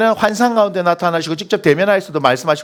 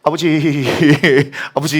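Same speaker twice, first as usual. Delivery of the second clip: lower, shaky mock tremble with a nervous laugh; a son faking a trembling plea to his father; 4 semitones lower than usual. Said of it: "떨리는 목소리로". (떨리는 목소리로 아버지를 부르듯)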